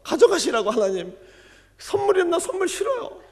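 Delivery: high, loud upset protest; angry crying refusal. Retold or imitated. Deaconess crying out to God, high and loud. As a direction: refuse the gift through tears, loud and high. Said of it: "너무 화가 나가지고 더 울었대요".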